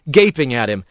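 ordinary delivery, nothing marked

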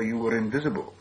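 ordinary delivery, nothing marked

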